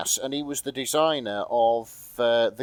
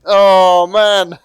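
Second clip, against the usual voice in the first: excited exclamation; 7 semitones higher, 14 dB louder than usual. Real, not acted. Reacting to absurd fic title.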